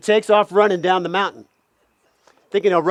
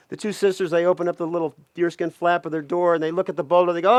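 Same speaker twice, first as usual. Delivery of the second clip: usual speaking voice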